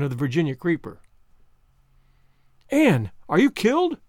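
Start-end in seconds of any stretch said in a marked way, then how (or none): 2.7-4.0 high, alarmed cry, short of a scream; frantic high-pitched question; shrieked. A narrator's reading. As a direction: cry out in panic to an injured friend. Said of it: "shrieked Diana".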